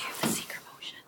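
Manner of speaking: hushed, voiceless aside; very soft whispered question; whispering. Real, not acted. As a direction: whisper it privately during a meeting. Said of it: "Why are we whispering?".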